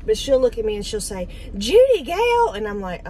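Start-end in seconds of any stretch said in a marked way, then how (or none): 1.6-2.5 much higher, louder stern call of her full name; scolding mom. Retold or imitated. Mother warning her daughter after a bad remark, high loud scolding call. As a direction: call your grown daughter by first and middle name like she's in trouble. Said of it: "here's exactly how she says it".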